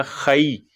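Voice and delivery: खई with a clear open "a-ee" diphthong; correct pronunciation. Shown as a demonstration. Teacher modelling the correct vowel in खई. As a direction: say खई, not खै. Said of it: "खै गलत हो जाएगा".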